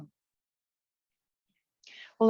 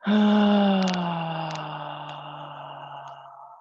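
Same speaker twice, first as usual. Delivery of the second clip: long open "ahh" sigh, falling and fading away; voiced exhale sigh. Shown as a demonstration. Guide showing how to release tension, long voiced sighing exhale. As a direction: let a sustained sigh pour out on the out-breath. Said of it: "make sound on that exhale".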